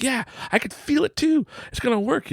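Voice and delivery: high, playful believing voice; mock childlike excitement. Dad playing along with son, high mock excitement. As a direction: play along with kid's magic.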